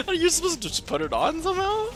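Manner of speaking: high-pitched words squeezed through real laughter; laughing helplessly. Real, not acted. Boyfriend has no answer for girlfriend, cracking up laughing. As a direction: try to speak while cracking up. Teller cracking up while quoting himself.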